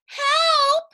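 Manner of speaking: high, loud cry for help; calling for help. A narrator's reading. Wife calling again from the hole, loud cry for help. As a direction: call out desperately. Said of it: "Missus Watson called again".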